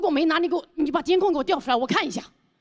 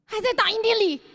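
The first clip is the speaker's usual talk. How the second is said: foreigner speaking mandarin; 9 semitones higher than usual. Retold or imitated. Thomas answers, foreigner voice.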